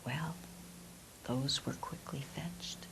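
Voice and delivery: ordinary delivery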